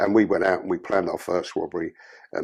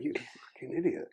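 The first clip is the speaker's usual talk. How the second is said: muttered self-rebuke; 4 semitones higher, 10 dB quieter than usual. Retold or imitated. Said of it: "I went".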